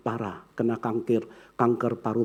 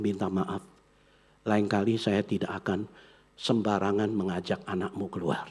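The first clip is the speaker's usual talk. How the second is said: softer, lower humble apology; a softer, lower, humble mother-in-law; gentle apology; 5 semitones lower, 6 dB quieter than usual. Retold or imitated.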